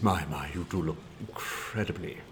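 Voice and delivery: lightly voiced theatrical gent, low and slow; a hint of a velvety actor purring a compliment, slowly; Simon Callow. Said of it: "and he said".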